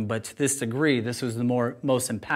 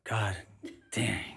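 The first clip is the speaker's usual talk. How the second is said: muttered frustration, very soft; quiet self-rebuke; 4 semitones lower, 10 dB quieter than usual. Real, not acted. Speaker loses place in poem, mutters softly. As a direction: scold yourself under your breath. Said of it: "I thought I had it".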